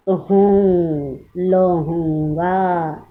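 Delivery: हूँ and लहूँगा stretched slowly, nasal vowel; teacher spelling out लहूँगा slowly for the class; slow nasal demo. Shown as a demonstration. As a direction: draw out हूँ in लहूँगा through the nose. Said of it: "ऐसे पढ़ेंगे".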